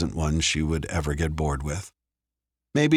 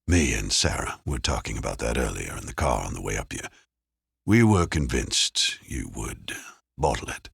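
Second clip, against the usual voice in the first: a hint of a relaxed detective; lightly voiced, easygoing man; lower male voice; 6 semitones lower than usual. A narrator's reading. Dave voiced by narrator.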